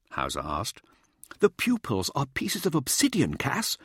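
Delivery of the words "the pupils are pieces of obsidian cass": high, eager showboater. A narrator's reading. Showboater comes towards a colleague with his discovery, eager and high. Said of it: "Mirza said eagerly".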